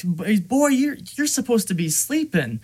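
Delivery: scolding. Retold or imitated. Gunsmith to captive he calls son, scolding.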